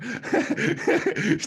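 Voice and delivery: high breathless laugh; a real high laugh bursting out. Real, not acted. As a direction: laugh high at your own joke. Laughing at his own retort.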